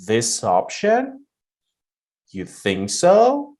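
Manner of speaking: slow, with "option" and "so" each lifting up; rising intonation to confirm. Shown as a demonstration. Teacher models confirming what a partner said, rising on "option" and "so". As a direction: check back, voice climbing on "option" and on "so". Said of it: "whenever you say it like this".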